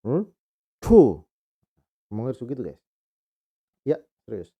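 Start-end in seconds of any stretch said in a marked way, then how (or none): 0.8-1.2 "cu" with rounded lips, teeth together, tongue curled back; Mandarin zh sound. Shown as a demonstration. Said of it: "Kayak yang ini".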